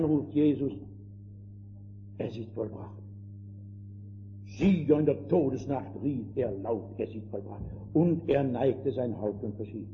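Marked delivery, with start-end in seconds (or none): 2.2-3.0 hushed last words. Acted. Jesus's last cry, hushed. 7.0-7.6 hushed last words. Acted. Jesus's cry repeated softly.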